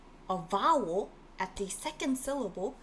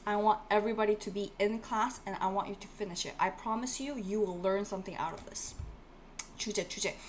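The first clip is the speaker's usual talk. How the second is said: usual speaking voice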